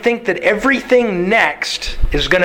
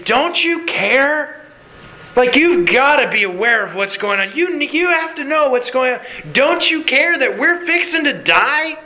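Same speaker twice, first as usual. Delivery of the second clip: high-pitched, incredulous plea; frantic pleading; 7 semitones higher than usual. Acted. Disciples waking Jesus in a sinking boat, frantic pleading. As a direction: plead urgently, pitch pushed well up.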